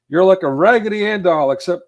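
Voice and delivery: loud, high mocking tirade; acted-out berating, high and punchy; ripping into him. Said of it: "like, ripping into him".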